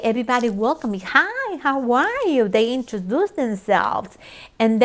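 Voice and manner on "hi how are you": high, sing-out greeting; imitated cheery welcome. Congregation greeting the newcomer, effusively friendly. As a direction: imitate an effusive hello, pitched way up.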